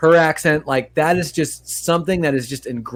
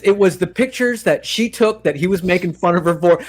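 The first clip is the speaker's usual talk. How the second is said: ordinary talk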